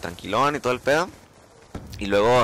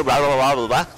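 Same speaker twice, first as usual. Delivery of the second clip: louder mock gibberish instructions; unintelligible examiner; 4 dB louder than usual. Examiner giving instructions he cannot understand, imitated gibberish. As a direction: blurt it like garbled orders. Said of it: "nomás le entiendo".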